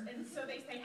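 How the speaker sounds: ordinary talk, nothing marked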